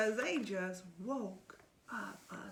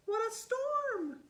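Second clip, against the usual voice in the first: much higher, chirpy little bird voice; twittering wren; 12 semitones higher, 7 dB louder than usual. Acted. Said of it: "twitters wren".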